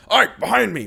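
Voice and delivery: loud, high urgent warning; warning shout. Acted. Gatry warning Ike of arrows, loud urgent shout. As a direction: yell to save a friend.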